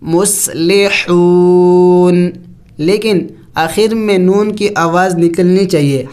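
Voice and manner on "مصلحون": مصلحون slow, final نون silent yet heard; مصلحون drawn out, ending in a light ن; stopping on the nun. Shown as a demonstration. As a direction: stretch مصلحون, close on a sounded نون. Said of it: "کیسے پڑھیں گے".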